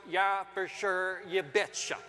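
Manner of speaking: put-on slow, drawn-out Minnesota accent. Husband imitating his wife's home state, Minnesota accent. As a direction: stretch it like a Minnesotan. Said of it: "my wife is from Minnesota".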